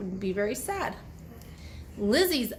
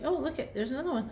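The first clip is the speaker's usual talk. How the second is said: ordinary delivery, nothing marked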